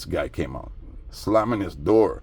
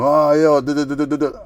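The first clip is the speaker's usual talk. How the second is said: higher, louder gibberish parody; mock babbling neighbor; 7 semitones higher, 6 dB louder than usual. Mocking the wallet thief accusing him, high babbling. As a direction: babble like a fool who won't stop.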